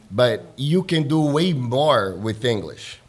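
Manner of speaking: ordinary talk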